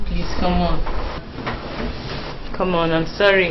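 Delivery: soft pleading. Acted. Wife softly begging her jealous husband's forgiveness. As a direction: coax him gently out of his anger.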